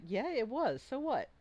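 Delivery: lightly acted kobold defiance; defiant kobold comeback. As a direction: brush off the insult as a brazen kobold. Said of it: "this one's like".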